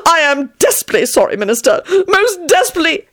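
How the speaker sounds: high, panicky grovelling; frantic apology. A narrator's reading. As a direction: grovel desperately, pitch way up. Terrified apology to boss.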